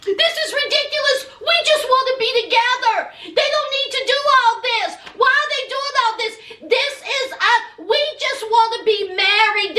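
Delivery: mock outraged Jeannie pleading, pitched high; hammed-up high protest about love. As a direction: whine it up high like a lovesick girl. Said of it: "She says".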